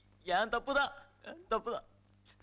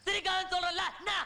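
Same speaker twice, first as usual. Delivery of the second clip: angry; 6 semitones higher than usual. Acted. Drunk man snaps at laughing friends.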